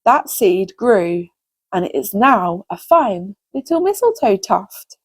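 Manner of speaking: plain reading voice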